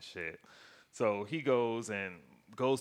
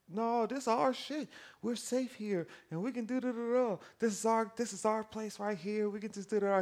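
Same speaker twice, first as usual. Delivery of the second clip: Wanda in denial; 13 semitones higher than usual. Retold or imitated. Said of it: "She like".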